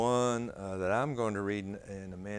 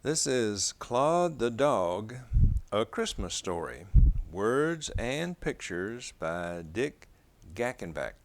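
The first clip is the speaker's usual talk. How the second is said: put-on reading to children; 7 dB louder than usual. A narrator's reading. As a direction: read the title page aloud to little ones. Reading picture book aloud.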